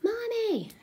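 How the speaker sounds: put-on high, whispered child's call; imitating child calling. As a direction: play the little boy calling for mommy. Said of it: "he's like".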